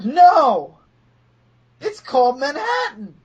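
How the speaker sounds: hammed-up exasperation; exasperated correction. Acted exasperated correction of a friend.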